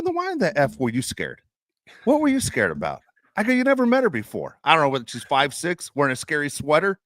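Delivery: high-pitched incredulous tirade, replayed; reenacting chewing someone out in disbelief; retold rant. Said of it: "So I just laid into her".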